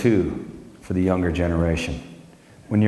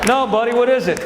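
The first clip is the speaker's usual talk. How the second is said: playful dad; 13 semitones higher, 4 dB louder than usual. Retold or imitated. Dad asking son.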